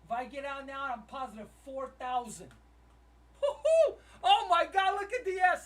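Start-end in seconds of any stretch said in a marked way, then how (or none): 3.4-5.7 loud, high whoop and exclamation; real thrilled shouting; very excited. Real, not acted. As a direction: whoop in amazement at the gains. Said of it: "I'm just so freaking excited".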